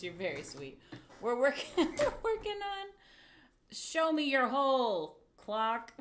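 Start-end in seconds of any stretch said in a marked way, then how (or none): 1.2-2.9 high, loud words broken by laughter; laughing. Real, not acted. Streamer answering chat, laughing. 3.7-5.9 loud, slow, grand title call; mock announcing title. Streamer naming her clock project, loudly proclaiming.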